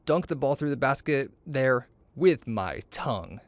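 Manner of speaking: plain reading voice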